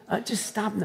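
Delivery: soft, hesitant little stop; mock timid request. Preacher mocking a timid warning to a child, soft and hesitant. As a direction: say it quietly and tentatively, with no urgency. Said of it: "Would you say".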